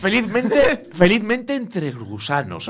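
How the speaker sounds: drawn out slowly, savouring every word; slow relish. Acted. Joking about dying happy, stretching the phrase slowly.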